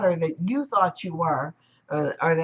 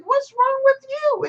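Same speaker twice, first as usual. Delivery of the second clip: puzzled wave; 6 dB louder than usual. Acted. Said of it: "looking at him like".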